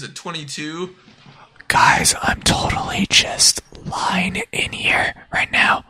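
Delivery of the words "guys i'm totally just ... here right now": loud, slow stage whisper; drawn-out, voiceless pretend ASMR; mock ASMR whisper. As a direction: whisper slowly, right up close to listeners. Improvised sample of ASMR streaming.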